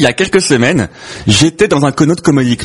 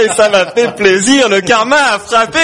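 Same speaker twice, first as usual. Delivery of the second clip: triumphant outburst; 7 semitones higher than usual. Acted. Comedian reveals that karma struck the person who blacklisted them, triumphant. Gleeful punchline of a revenge story.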